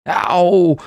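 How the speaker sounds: sudden high, loud yelp; sharp pained shriek. A narrator's reading. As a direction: cry out as teeth sink in. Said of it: "I shrieked".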